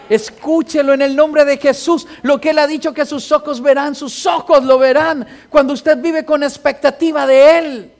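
loud, proclaiming preacher's cadence, hammering each repeated verb; preacher proclaiming to the congregation how his mother prayed, loud and fervent; preaching at full force. Acted.

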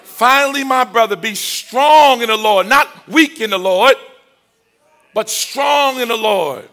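loud, high, stretched key words; full-force preaching. Real, not acted. Preacher urging the church to be strong, full-force preaching. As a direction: ring it out over the congregation.

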